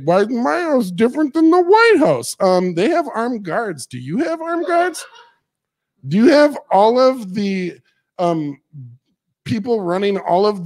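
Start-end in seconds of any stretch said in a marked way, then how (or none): 0.0-2.3 mocking whine. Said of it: "They're like". 3.8-7.8 mocking question. Sarcastic rhetorical jab.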